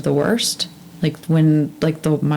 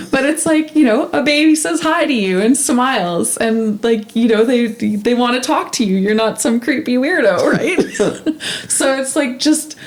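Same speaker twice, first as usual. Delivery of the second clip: laughing while talking; 8 semitones higher than usual. Real, not acted. Laughing through baby story.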